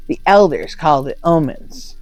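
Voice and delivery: plain reading voice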